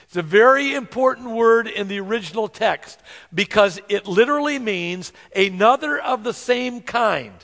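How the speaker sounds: ordinary talk, nothing marked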